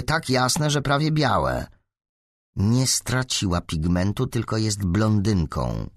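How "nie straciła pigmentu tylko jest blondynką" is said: ordinary delivery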